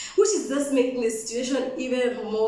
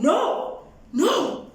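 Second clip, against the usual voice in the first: higher, sharp shouted no; retold shout, refuse firmly twice; shouted refusal; 6 semitones higher, 3 dB louder than usual. Woman refusing to return to bed, shouted refusal. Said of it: "I'm shouting".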